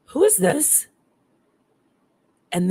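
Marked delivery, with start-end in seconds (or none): 0.1-0.9 higher-pitched, uneasy question; a touch of fear, retold without raising the volume; retold scared question. Asking the dead man's caller who it is, uneasy. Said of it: "like I was scared".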